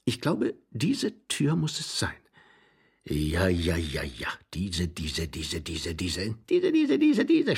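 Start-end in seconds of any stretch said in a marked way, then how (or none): 3.0-6.3 low-pitched, rapid repeating; low, rhythmic chant of one word; low muttered repetition. Acted. Character repeats word, mounting agitation. 6.3-7.6 high-pitched insistent squeal; high, excited rapid repeating; high agitated repetition. Acted.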